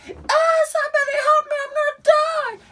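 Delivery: high mock cry for help; hammed-up dying plea. Imagined bleeding out.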